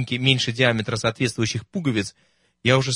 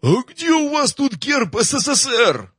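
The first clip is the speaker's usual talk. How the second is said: медленный вопль намного выше и громче; прогреми на всю казарму; громогласный вопль; 13 semitones higher, 4 dB louder, slower than usual. Acted. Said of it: "громогласным воплем". (громогласный вопль маршала)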